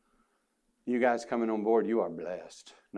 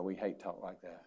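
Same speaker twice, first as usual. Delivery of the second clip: softly echoing the line to the congregation; quiet rueful repeat; 5 semitones lower, 14 dB quieter than usual. Shown as a demonstration. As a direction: repeat it much softer, HATE held a beat. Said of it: "Boy, we hate talk like that".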